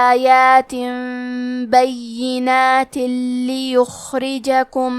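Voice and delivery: slow, drawn-out melodic tajweed chant; Quran recitation. Acted. Teacher recites the verses for the lesson, slow melodic chant. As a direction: recite slowly, stretching each vowel.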